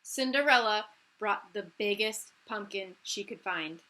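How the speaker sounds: ordinary delivery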